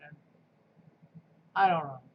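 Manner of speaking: ordinary delivery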